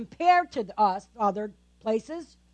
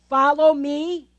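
God calling; 3 semitones higher, 7 dB louder, slower than usual. Acted. God's call voiced slowly.

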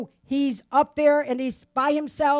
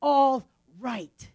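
calm reassurance; much slower than usual. Acted. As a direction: soothe frightened men in a storm. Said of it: "he says".

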